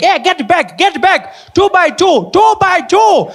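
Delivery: high, rising frantic calls; a teacher losing control of the kids, calling out; panicking teacher. Retold or imitated. Teacher panicking as kids run wild, high frantic shouting. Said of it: "She's panicking, like".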